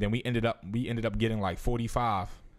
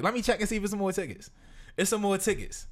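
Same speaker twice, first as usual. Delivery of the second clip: lightly voiced friend, higher; a hint of Liv, pitched up; raised voice for Liv; 8 semitones higher than usual. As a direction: mimic a friend checking tickets on her phone.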